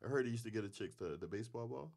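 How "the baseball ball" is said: ordinary talk, nothing marked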